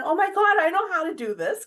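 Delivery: high, loud burst of recognition; light up with sudden delight; retelling her thrill on recognizing the meditation; excited realization. Retold or imitated.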